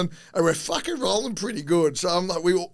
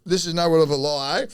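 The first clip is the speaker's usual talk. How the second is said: ordinary delivery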